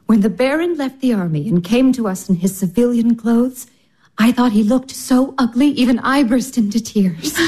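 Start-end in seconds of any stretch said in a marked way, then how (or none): none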